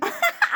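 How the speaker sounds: sudden high-pitched laugh of delight. Real, not acted. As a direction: burst out laughing, amused. Mother laughs at child's answer.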